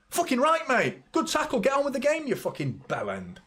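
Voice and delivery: very high-pitched telling-off, dropping at the end; mock exasperated retort. Retort to Mbappe over a good tackle, mock exasperation. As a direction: dismiss the complainer in a high, scornful rant. Acted retort to the complaining star.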